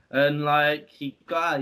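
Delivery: ordinary talk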